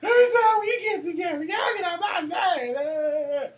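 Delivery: high, loud mock wailing, drawn out; hammed-up crying. Storyteller acts out crying at the family fight, mock bawling. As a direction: wail like an upset kid, exaggerated for comedy. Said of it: "I just start crying".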